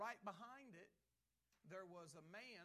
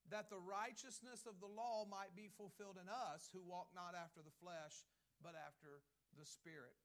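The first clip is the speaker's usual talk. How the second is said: scripture reading; 4 semitones higher, 6 dB louder than usual. Acted. Pastor reads Romans 8 aloud.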